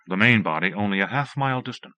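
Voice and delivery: plain reading voice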